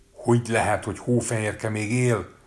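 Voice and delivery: high, loud furious shriek; shrieking queen. A narrator's reading. Queen shrieks at the mirror in fury. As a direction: screech it out in rage. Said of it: "visított vérvörös arccal a királyné".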